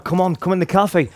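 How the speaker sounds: retold excited invitation, pitched high; acting out an excited call to come over; eager beckoning. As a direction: urge him along in a high, eager voice. Excitedly beckoning lad into the cafe.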